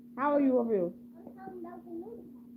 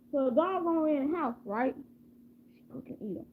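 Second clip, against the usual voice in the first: ordinary delivery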